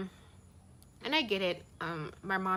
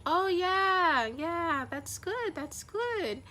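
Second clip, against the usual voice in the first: much higher, louder imitation of mom's sudden cheerful yes; mom caught off guard; 11 semitones higher, 4 dB louder, much slower than usual. Mom reacts to the travel plans with a high startled cheer. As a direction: do mom's startled, sing-song approval. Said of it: "she was like".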